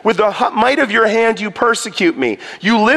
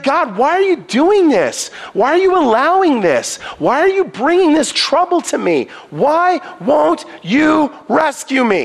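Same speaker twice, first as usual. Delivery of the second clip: mad Habakkuk; 7 semitones higher than usual. Retold or imitated. Habakkuk angrily demanding answers from God. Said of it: "Habakkuk was mad".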